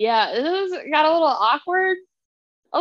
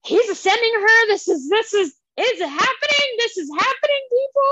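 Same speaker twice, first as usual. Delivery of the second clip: much higher and louder, building thrill; excited realization; 9 semitones higher, 3 dB louder than usual. Retold or imitated. Reader telling her co-host she finally got the scene, excited high pitch. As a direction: replay the moment it clicked, all worked up. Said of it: "I was like".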